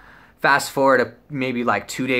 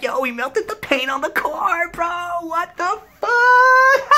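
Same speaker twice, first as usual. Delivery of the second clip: very high, laughing through the words; 5 dB louder, slower than usual. Retold or imitated. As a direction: cackle it out in disbelief. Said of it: "we start laughing like".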